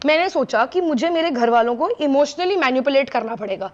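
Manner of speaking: ordinary talk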